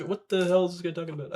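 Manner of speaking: ordinary delivery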